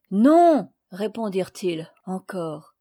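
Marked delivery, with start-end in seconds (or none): 0.1-0.7 loud, firm chorus no; shouted no. Acted. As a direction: answer as one, loud and firm. Friends answer again together.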